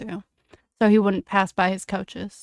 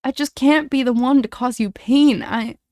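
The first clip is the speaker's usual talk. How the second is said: higher, anguished plea, mildly acted; voice breaking; 5 semitones higher, 5 dB louder than usual. A narrator's reading. James refusing to hurt the other boy, voice breaking. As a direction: let a touch of breaking into a raised voice. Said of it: "voice breaking".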